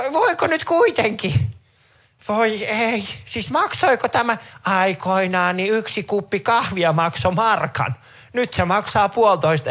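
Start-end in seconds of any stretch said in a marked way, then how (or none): 0.1-7.9 put-on high, slow doddering old lady; confused granny. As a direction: drag it out like a lost old granny. Granny at checkout.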